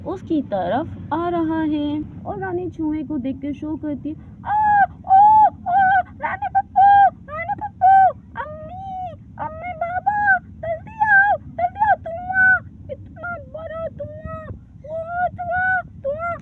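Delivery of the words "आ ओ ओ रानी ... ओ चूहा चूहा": high, loud panicked shrieks; terrified shrieking. Acted. Rani spots a big mouse and shrieks for her parents, high and loud.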